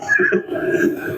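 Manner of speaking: airy chuckle; laughter. Real, not acted. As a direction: laugh off the joke. Laughs after quote.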